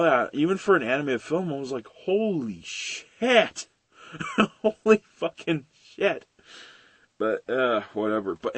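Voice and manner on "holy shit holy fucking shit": slow, high, drawn-out disbelief; amazed swearing. Retold or imitated. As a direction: stretch the curse in amazement. Retells amazed reaction to film.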